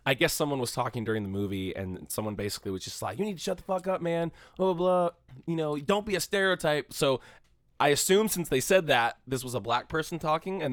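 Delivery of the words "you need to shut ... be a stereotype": a mild mock yell, pitched higher; retold yell. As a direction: retell a stranger telling someone off in the cinema, lightly.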